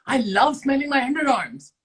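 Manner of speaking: high, loud, thrilled delivery; excited. Pretend. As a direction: burst out with excitement on every word. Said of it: "okay, excited".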